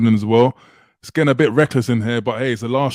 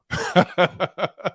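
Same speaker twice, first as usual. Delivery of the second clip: a short laugh at the chat; amused laugh; 5 semitones higher, 3 dB quieter than usual. Real, not acted. Host laughing at a viewer's jab. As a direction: laugh it off lightly. Reading a cheeky super chat.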